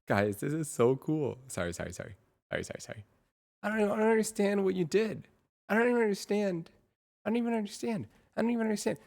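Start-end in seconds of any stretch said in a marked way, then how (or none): 3.6-9.0 high-pitched, excited bafflement; real giddy disbelief, voice way up; amazed disbelief. Real, not acted. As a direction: squeal it out, totally astonished. Baffled by the amazing result.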